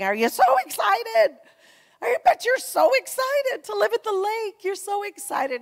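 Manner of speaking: high, loud gushing mimicry of friends asking if she's excited; mock gushing friends. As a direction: ham up the sugary "so excited" people. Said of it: "everybody in my life kept saying".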